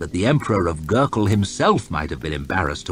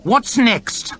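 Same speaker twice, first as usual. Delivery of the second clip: high, disdainful lordly voice; a bored, arrogant official; haughty lord; 4 dB louder than usual. Acted. Lord chamberlain announcing to his court, disdainful. Hoff demands the next case.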